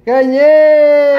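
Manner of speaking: loud, high cry of victory; excited shout. Real, not acted. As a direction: shout the win. Dad wins rock paper scissors.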